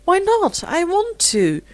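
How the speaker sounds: high, sharp, defiant comeback; retorting. A narrator's reading. Rosemary retorting to Philip, defiant and high. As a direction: snap back high and stubborn. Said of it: "retorted Rosemary".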